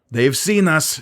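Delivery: alarmed lookout cry. A narrator's reading. Sisay warns crew enemy spotted, alarmed.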